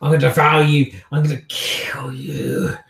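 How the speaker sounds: frenzied kindred threatening to devour; menacing monster. Acted. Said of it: "literally the kindred looks at you".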